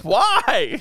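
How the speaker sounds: high, baffled "why"; incredulous squeak. Retold or imitated. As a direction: squeak it in disbelief. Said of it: "You're like".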